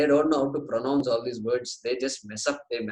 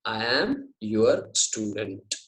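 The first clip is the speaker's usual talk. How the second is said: slow, word-by-word "I am your student"; very clear; 5 semitones lower, much slower than usual. Shown as a demonstration. Teacher translating Arjuna's words, very clearly and slowly. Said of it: "He says it very clearly".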